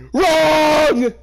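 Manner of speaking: high laugh bursting into a loud yell; cackle, then bellow the word; mock outrage at the shipping; laughing then shouting. Real, not acted. Laughing, then yells at the ship.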